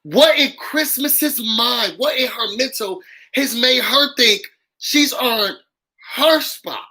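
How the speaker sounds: each word dragged out in disbelief; slow, pointed rhetorical question. Real, not acted. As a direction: stretch it out like you can't believe it. Repeated incredulous question.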